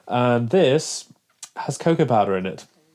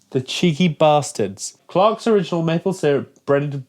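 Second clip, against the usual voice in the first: usual speaking voice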